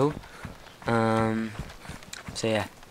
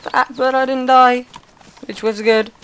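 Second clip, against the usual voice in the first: much higher and louder, thrilled; excited relief; 13 semitones higher, 7 dB louder than usual. Real, not acted. Player after surviving a fall, excitedly relieved. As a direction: squeal it out, glad to be alive.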